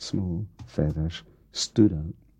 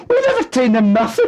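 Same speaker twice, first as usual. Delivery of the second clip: very high, loud shout; wife yelling denial at husband; angry shout; 11 dB louder than usual. Acted. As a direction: scream the denial.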